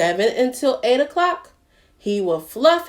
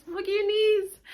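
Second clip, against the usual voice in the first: high playful echo; 11 semitones higher, 5 dB quieter than usual. Acted. Wiggle song with kids.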